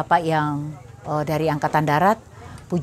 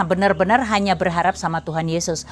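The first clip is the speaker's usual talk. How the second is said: ordinary talk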